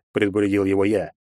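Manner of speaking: usual speaking voice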